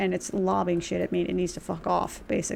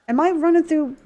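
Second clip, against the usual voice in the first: surprised; 8 semitones higher, 6 dB louder than usual. Real, not acted. Suddenly recognising the zone.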